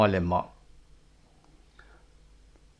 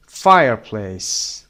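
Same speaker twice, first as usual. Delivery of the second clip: "Fireplace" slowly, "Fire" punched; first-syllable stress; 6 dB louder, much slower than usual. Shown as a demonstration. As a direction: hit FIRE in "Fireplace", drawn out. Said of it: "The first syllable is stressed". («Fireplace» آهسته با تکیه روی بخش اول)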